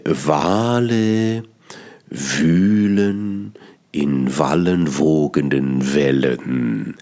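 slow lilting recitation, each w lingered on; poetic recital. Shown as a demonstration. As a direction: recite like a rolling wave, slowly. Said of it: "Das sind nur Anfangsreime".

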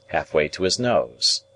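ordinary delivery

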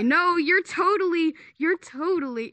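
high, emphatic mock retort; retold protest. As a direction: push back insistently, pitch jumping up. Retelling his retort in an argument.

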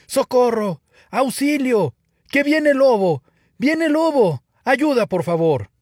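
high, loud cries of alarm; shouting for help. A narrator's reading. Shepherd boy calling villagers from the hill, shouting. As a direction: yell out in pretend panic. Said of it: "comenzó a gritar".

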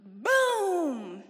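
loud mock blast; imitated explosion boom. Daughter imitating the blast on the line, loud boom. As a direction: shout boom like a bomb going off.

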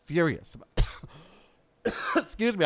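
coughing from smoky air; throat catching on smoke. Real, not acted. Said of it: "I have smoke in my throat".

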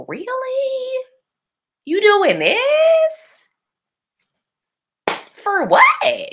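very high, stretched-out disbelief; acted incredulity. Her reaction to two men's behaviour, acted incredulity. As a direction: question it in a high, baffled squeal.

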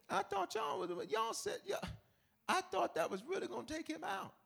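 high, hushed squeaky panic; dismayed brothers. Acted. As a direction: whine it high and small, as a secret accusation. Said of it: "they're looking at him like".

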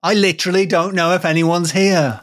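high-pitched mock bewilderment; hammed-up unaware act; feigning ignorance. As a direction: play cluelessly innocent. Friend joins in as feigning hero.

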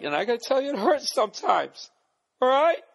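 high, loud, whiny fake hurt; a pouty, hammed-up whimper; mock wounded whine. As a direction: ham up being hurt like a wounded diva. Mock hurt complaint about tweets.

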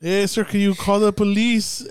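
a hint of a desperate man at the door, drawn out; voicing the stranger.

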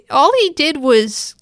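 plain reading voice

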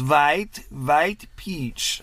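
imitated Persian accent, W as V; Persian accent, strong V. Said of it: "said with a strong V".

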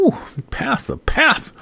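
breathless, high swoon over the pastor; half-whispered, lovestruck sigh of his name. Retold or imitated.

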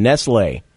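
"Nestle" as NESS-lay, falling; true Nestle pronunciation. Shown as a demonstration. Host citing a source for the name, true pronunciation. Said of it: "Is the actual true pronunciation".

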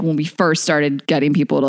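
ordinary talk

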